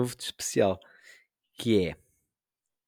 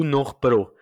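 ordinary delivery

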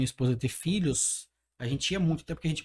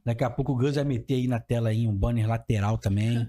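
ad read; 6 semitones lower than usual. Acted. Leitura de patrocínio.

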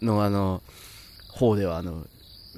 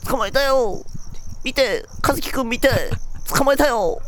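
put-on high, gleeful kid voice; excited little boy; 12 semitones higher than usual. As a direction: brag like a child showing off a catch. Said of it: "男児にさ、捕まえられてさ".

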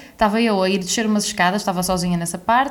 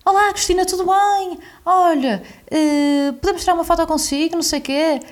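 high, bright-eyed mock politeness to a celebrity; friend's eager greeting; 8 semitones higher than usual. Friend greets Cristina Ferreira, high eager mock voice. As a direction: play the bold friend greeting Cristina, pitched up. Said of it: "e ele diz assim".

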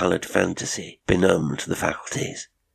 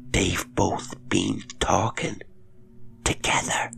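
whisper; 4 dB quieter than usual. A narrator's reading. Cook tells governess of the voices, whispering. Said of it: "the other's whisper".

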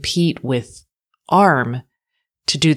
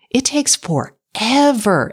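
FOREVER dragged out slowly and higher; exasperated emphasis; 7 semitones higher, slower than usual. Real, not acted. Complaining about a slow app.

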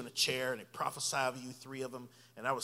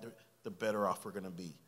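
ordinary delivery

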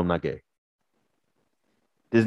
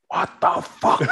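retold angry dad; slower than usual. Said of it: "angry phone call".

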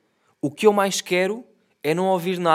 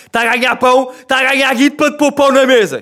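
mock father lecture; 7 semitones higher, 9 dB louder than usual.

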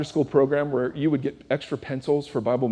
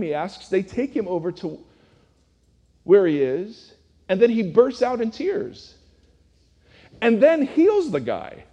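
normal talking voice

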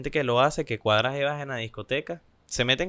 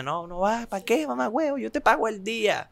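mock insistent buddy, much higher; pushy friend; 8 semitones higher than usual. Friend urging him to skip work, high and pushy. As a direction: talk him out of leaving, pitched up high.

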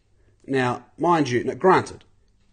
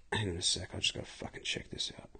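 real whisper; 9 semitones lower, 18 dB quieter, faster than usual. Real, not acted. Pauses podcast to check something.

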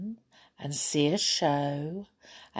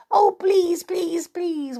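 much higher, whiny mock begging; imitating her children; 13 semitones higher, 4 dB louder, slower than usual. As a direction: copy the kids' wheedling please. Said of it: "my children say".

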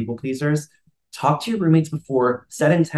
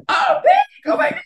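put-on very high gushing girlfriend greeting; girly rescue squeal; 4 dB louder than usual. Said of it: "seven girls just walk in".

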